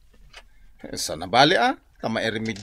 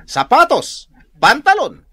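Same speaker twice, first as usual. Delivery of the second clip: excited listing; 7 semitones higher, 8 dB louder, much slower than usual. Acted. Listing his expensive things.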